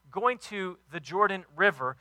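usual speaking voice

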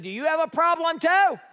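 high-pitched challenge from a fed-up dad; exasperated. Retold or imitated. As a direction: throw it at the kid, mock-outraged. Exasperated dad challenges third child.

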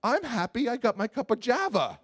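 wife brushing off her husband, carefree; breezy wife. Retold or imitated. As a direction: shrug it all off, unbothered. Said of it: "And she's just like".

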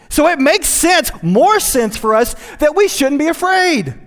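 high, loud, driving sermon voice; full-force preaching. Acted. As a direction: preach it out to the congregation.

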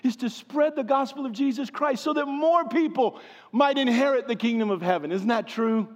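rising, fervent sermon delivery; preaching. Acted. Pastor urging his congregation to repent, preaching. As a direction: preach it with urgency.

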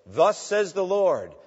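high, loud, slow prophetic declaration; drawn-out, ringing herald's cry; prophet's proclamation. Retold or imitated. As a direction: proclaim it like an Old Testament prophet. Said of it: "They say".

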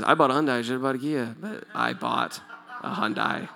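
low, fading jokey patter; mock tongues phrase. Shown as a demonstration. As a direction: say it like pretend tongues, trailing off. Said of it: "It sounds like tongues".

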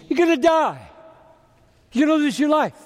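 high, forceful preacher's warning; urgent, high-pitched pronouncement; emphatic warning. Real, not acted. Preacher driving home the warning.

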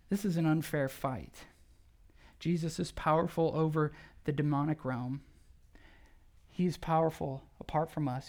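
ordinary delivery

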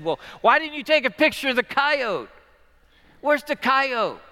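high, loud imitation of a curious child; child's question. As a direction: pitch it up like a kid asking. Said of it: "came up to me afterward and said".

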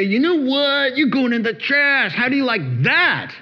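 playful mock anger at a broken faucet; mock telling-off. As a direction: scold the faucet like a triumphant foe.

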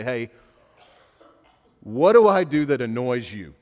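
a sudden loud, pointed question to the spouse; loud mock question to a spouse; exaggerated loud asking. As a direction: blurt the question out loud and long.

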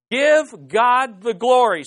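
slow, loud call to praise; slow, ringing declaration; emphatic proclamation. Shown as a demonstration. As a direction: proclaim it with weight on every word. Leading congregation in responses.

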